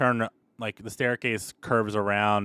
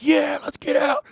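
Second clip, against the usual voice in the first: high eager shout; prisoners shouting; 8 dB louder than usual. Acted. Prisoners shouting eagerly to the guards, high excited yell. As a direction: cheer for escape. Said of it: "The prisoners are like".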